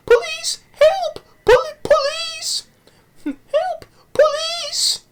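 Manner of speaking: hammed-up falsetto cry for help; mock screaming for help. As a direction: shriek for the police like a panicked old lady. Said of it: "then she screams".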